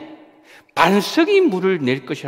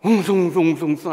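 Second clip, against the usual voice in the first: crowd murmur; 7 dB louder than usual. Retold or imitated. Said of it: "사람들 난리가 난 거예요".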